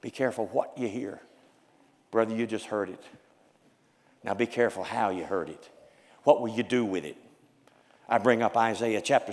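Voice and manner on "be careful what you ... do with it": low, soft, urgent counsel; low earnest warning. Acted. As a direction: lean in and warn them.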